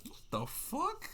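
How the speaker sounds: high, slow, stunned "what the fuck"; shocked disbelief. Retold or imitated. Retelling his reaction to the girl, high stunned disbelief. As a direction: recoil at the question in disbelief.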